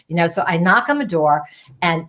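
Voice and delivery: plain reading voice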